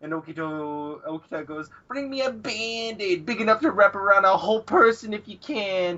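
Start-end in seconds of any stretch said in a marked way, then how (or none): 1.9-6.0 high, drawn-up plea; mock whining. Okita joining in the teasing, mock whining. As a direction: whine the request, hammed-up.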